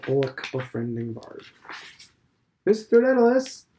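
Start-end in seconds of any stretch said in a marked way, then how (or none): 2.6-3.7 loud, high call across the yard. A narrator's reading. Old man hailing Stephen at the gate, loud call. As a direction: shout his name to stop him.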